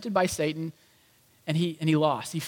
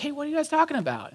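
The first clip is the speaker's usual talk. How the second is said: casual Jesus; 9 semitones higher, faster than usual. Retold or imitated. Jesus casually greets downcast disciples.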